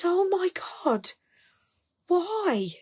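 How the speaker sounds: high, shocked disbelief; mother stunned by her son's news. Retold or imitated. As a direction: gasp it out in alarm. Shocked mother hearing of breakup.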